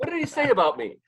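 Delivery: high and full, mock outraged; indignant high voice. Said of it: "'cause I'm like".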